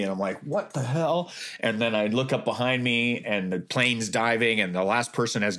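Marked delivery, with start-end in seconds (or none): 0.4-1.3 high-pitched, baffled exclamation; retold shock. Skydiver as something swooshes past, high startled disbelief. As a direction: act out being startled, pitch jumping up.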